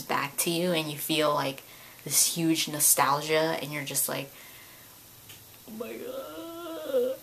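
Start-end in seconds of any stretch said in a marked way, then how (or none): 5.7-7.2 high, soft, slow sigh of awe; overwhelmed awe. Retold or imitated. Overwhelmed by childhood nostalgia, soft awe. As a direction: let it melt out in nostalgia.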